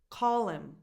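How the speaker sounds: "Call" stressed, "him" reduced to "im"; dropped H. Shown as a demonstration. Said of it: "not really pronouncing the H anymore".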